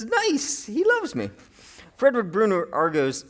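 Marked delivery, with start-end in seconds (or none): 0.0-1.3 slow, tender and higher; tender, touched. Acted. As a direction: savor it fondly, slowly. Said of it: "a term of affection or endearment".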